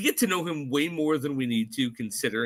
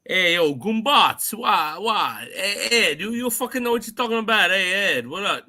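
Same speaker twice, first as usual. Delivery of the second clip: higher, louder, lightly hammed-up New York Italian accent; mock New York accent; 5 semitones higher, 4 dB louder than usual. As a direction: parody the film's bad New York accents.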